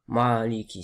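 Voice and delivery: "Maliki" with a dark, open "maa"; faulty demo, heavy "Maaliki"; heavy vowel. Shown as a demonstration. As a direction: darken the first vowel of "Maliki". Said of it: "C'est pas".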